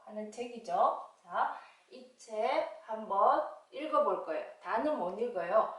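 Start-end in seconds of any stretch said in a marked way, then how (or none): none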